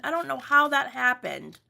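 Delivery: normal talking voice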